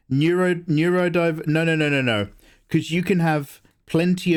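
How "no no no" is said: usual speaking voice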